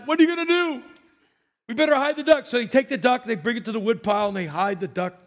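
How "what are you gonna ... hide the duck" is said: put-on high, louder panicky little girl; panicked Sally. Sally panicking to Bobby after the duck dies, high and loud. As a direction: blurt it out in a kid's panic.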